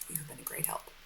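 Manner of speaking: breathy whisper, no voice; whispered aside. Acted. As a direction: murmur it under your breath. Aside after witness leaves.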